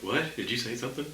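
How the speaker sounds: lightly voiced phone assistant; Siri voice. Joking about a phone assistant, light mock Siri. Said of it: "Siri on your phone is like".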